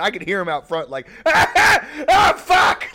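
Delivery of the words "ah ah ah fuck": imitating a loud, high cackling fit; dad laughing. Dad out front, in a loud imitated laughing fit. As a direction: mimic dad dying of laughter outside.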